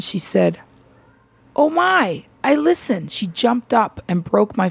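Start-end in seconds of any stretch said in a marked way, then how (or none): 1.5-3.1 high-pitched retold gasp of delighted recognition; excited recognition. Lily Tomlin recognizes the radio host at dinner, excited. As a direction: exclaim it high, as if suddenly starstruck.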